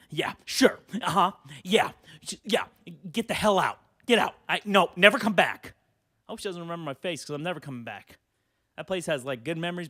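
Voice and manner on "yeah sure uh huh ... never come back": louder, scornful retold scolding; mock angry owner. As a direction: snap back disbelieving, then throw him out. Owner kicking him out.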